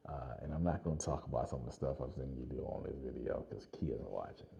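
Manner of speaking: dropped to a low unvoiced whisper; hushed aside. Real, not acted. Telling viewers about Stuart, whispered so Kia won't hear.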